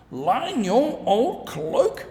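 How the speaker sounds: put-on outraged old farmer shouting at his wife; shouted. A narrator's reading. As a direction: throw her words back at her. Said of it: "shouted Mister McGregor".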